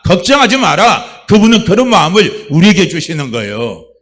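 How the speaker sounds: loud, rising preacher's cry of God's comfort; fervent preaching. Acted. Preacher voicing God to the church, booming and fervent. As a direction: shout the promise to the congregation.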